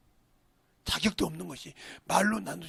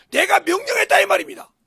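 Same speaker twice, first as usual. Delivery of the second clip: bold declaration; 12 semitones higher, 18 dB louder than usual. Acted. Repeats David's confession.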